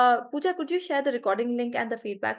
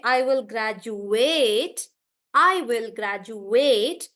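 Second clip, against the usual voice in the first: "graduate" slowly as grad-ju-ATE; long, full last syllable on "graduate"; verb stress; 4 dB louder, much slower than usual. Shown as a demonstration. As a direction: draw out the final ATE in "graduate". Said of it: "I'm stressing on ate".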